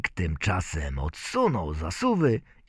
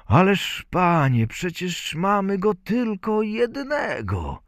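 protesting father; 11 semitones higher than usual. A narrator's reading. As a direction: plead against the gentleman's offer. Father objects to selling only son. (protestujący ojciec, wysokim głosem)

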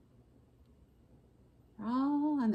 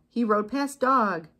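plain reading voice

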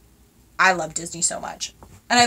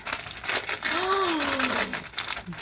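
long, drawn-out gasp of delight; amazed gasp; 7 semitones higher than usual. Real, not acted. Opening a gift, sees it.

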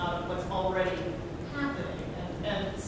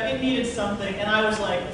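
normal talking voice

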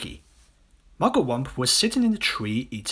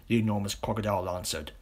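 ordinary delivery

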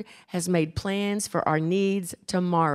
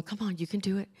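hushed encouragement, imitating a mother offstage; mom coaching from wings; 10 dB quieter, faster than usual. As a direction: urge the child on under your breath. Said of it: "Her mom was in the wings".

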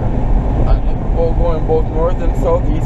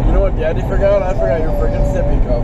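higher-pitched daddy talk; 5 semitones higher than usual. Real, not acted. Father asks little man what's the matter, higher-pitched child-directed talk.

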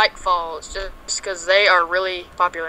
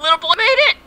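high cheering shout; triumphant cheer; 10 semitones higher, 4 dB louder, faster than usual. Real, not acted. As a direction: cheer the win. Celebrating the win.

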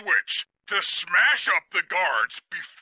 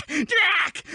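laughing; 9 semitones higher than usual. Acted. Laughing through tickling interrogation.

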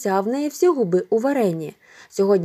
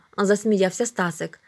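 ordinary delivery, nothing marked

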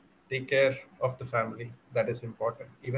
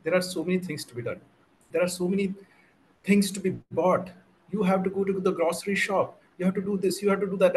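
lightly acted nagging, pitched higher; a hint of a wife's higher-pitched scolding; 6 semitones higher, faster than usual. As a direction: reel off chores like a mildly nagging wife. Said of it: "she said".

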